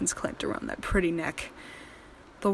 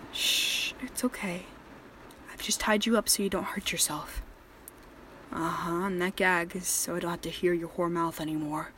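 soft and menacing; 2 semitones lower, 7 dB quieter than usual. Acted. Calm captor after knocking out.

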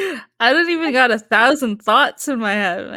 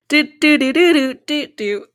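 ordinary talk, nothing marked

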